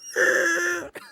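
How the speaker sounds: high-pitched fake sob; exaggerated ugly-cry wail; mock ugly crying. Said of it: "like, ugly crying".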